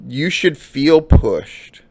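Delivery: slow and pointed. Real, not acted. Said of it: "slowed, pressing the point".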